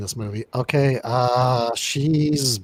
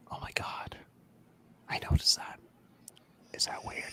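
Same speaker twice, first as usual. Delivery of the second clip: real hushed whisper; 15 dB quieter than usual. Real, not acted. Joking aside to friends on the couch, whispered. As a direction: whisper it to the friends beside you.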